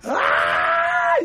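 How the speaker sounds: very high shrieking cry of fright; a child's piercing scream; terrified scream. Retold or imitated. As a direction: scream like a scared small child. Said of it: "me puse a gritar".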